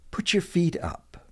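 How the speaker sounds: "Put your feet up" pitched high, then falling; casual model phrase. Shown as a demonstration. Teacher models phrase, then slower.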